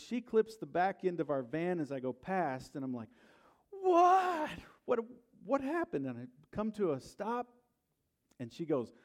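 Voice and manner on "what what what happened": high, loud startled cry of disbelief; shocked yell. Retold or imitated. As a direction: yell it like the car just hit you. Van clipped, shocked driver.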